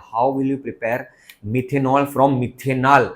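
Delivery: ordinary talk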